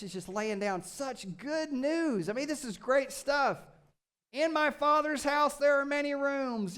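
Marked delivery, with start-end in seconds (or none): 4.3-6.8 high, loud, gleeful reading with cheers; excited cheering. Acted. Preacher to congregation, cheering over the promises. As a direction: cheer each promise like great news.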